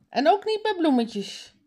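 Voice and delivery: high called-out afterthought warning; calling after someone. A narrator's reading. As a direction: call it after them, high-pitched. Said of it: "roept haar vader haar na". (hoog nageroepen)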